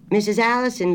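high, loud country grocer straining to hear; a country shopkeeper on a bad line; rustic grocer. A narrator's reading. Grocer asking her to repeat, high and loud.

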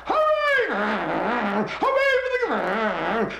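distant crowd cheering. Acted.

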